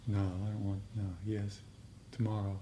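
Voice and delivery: usual speaking voice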